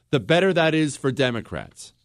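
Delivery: ordinary delivery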